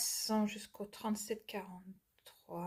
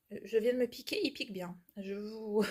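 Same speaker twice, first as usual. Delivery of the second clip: ordinary talk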